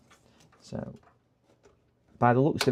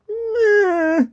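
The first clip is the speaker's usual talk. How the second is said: mock-disgusted meh; 6 dB louder than usual. Scoffing at PNY drive.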